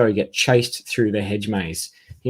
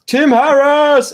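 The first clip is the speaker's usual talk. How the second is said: announcer flourish; 8 dB louder, much slower than usual. Real, not acted. Reading fan's comment with flourish.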